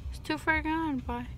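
ordinary delivery

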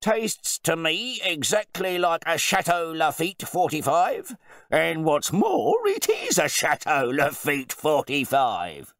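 loud, high proclamation, slowing grandly; booming, self-satisfied connoisseur; pompous wine bore. A narrator's reading. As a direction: declare it emphatically to the whole table. Host proclaims his wine.

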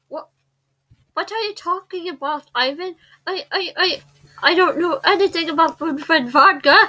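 put-on Russian-like Estonian accent; stammered denial in an Estonian accent. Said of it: "It's the same thing as Russia?".

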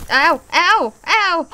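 high, loud cries of ow; loud yelps. Real, not acted. Player hit by the happy dancing creature, loud yelping. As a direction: yelp sharply at each blow.

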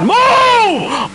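high, loud real scream. Real, not acted. Racer ordering others out of the way, screaming. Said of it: "I'm sorry for screaming".

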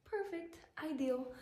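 high sarcastic perfect; dry, sarcastic. Real, not acted. Construction noise next to her.

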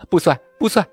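high-pitched outraged protest; indignant shouting. A narrator's reading. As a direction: cry out as a flustered young mother. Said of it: "掩耳大叫". (少妇气急地高声大叫)

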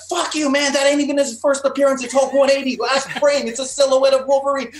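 very high, loud staged screaming; screamed comeback. Retold or imitated. Friend in a staged comic-book fight, screaming back. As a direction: yell back at the top of your lungs. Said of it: "started screaming at the top of my lungs".

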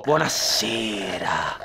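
very low, slow and soft; creepy dementor greeting. Acted. Dementor greets the cabin, creepily deep. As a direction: draw it out like a sinister visitor.

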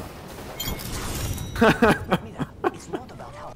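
high, wheezy laughing fit; helpless laughter. Real, not acted. Player amazed by teammate's play, high helpless laughing.

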